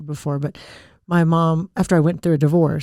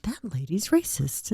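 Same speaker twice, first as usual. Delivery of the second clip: soft, slow retold aside; muttered retold reaction; 2 semitones higher, 9 dB quieter than usual. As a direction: quote her slowly, under your breath. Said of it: "and she was like".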